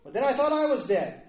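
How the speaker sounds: high, loud cry of astonishment; amazed exclamation. Acted. Hobbit to Gandalf he thought dead, amazed exclamation. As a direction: call out in joyful disbelief at seeing him alive. Acting out amazed reunion quote.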